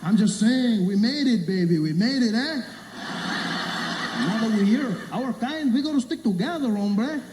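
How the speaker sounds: he's thrilled you're his kind, so push it; Mexican stranger insisting. Retold or imitated. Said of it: "He said".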